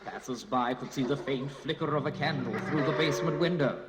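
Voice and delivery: ordinary delivery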